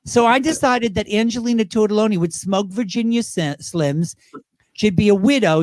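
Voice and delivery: usual speaking voice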